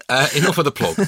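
normal talking voice

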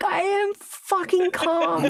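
very high, drawn-out mock fury; angry protest of calm. He insists to her he is calm, mock furious. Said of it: "I think you need to calm down".

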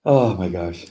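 normal talking voice

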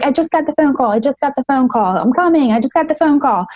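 high, breathless nurse rushing in with the news; she's running at you, blurting it over and over; frantic nurse. Retold or imitated. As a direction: nurse sprinting in, repeating the news in a high rush.

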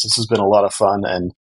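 normal talking voice

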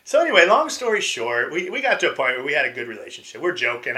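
ordinary talk, nothing marked

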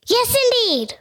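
high-pitched, emphatic little reply; speaking up. Real, not acted. Child told to speak up, answering in high voice. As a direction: pitch it right up for the listeners. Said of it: "you have to speak up".

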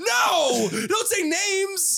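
high, loud, slow laughing shout; real laughing protest. Real, not acted. Cutting off the brother saying names, laughing shout. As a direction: yelp it out through laughter. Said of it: "no names on air".

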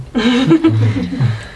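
high-pitched burst of laughter. Real, not acted. GM laughs at own joke.

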